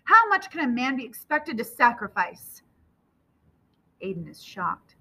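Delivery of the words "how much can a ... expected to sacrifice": anguished outburst, pitched up; father at the end of his tether; thundering. A narrator's reading. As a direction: protest with raised pitch. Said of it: "his father thunders".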